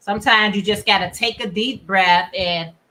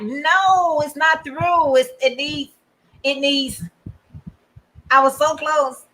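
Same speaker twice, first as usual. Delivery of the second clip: higher-pitched, whiny-style protest in play; mock dismay; 5 semitones higher than usual. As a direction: act out disappointment at a nearly finished project. Acting out finding a project unfinished.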